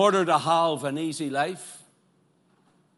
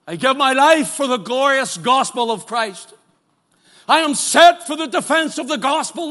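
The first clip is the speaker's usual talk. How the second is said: louder, higher proclaiming of Paul's words; fervent preaching; 8 semitones higher, 9 dB louder than usual. Acted. Preacher voicing Paul giving his life for the gospel, proclaiming. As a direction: declare it boldly.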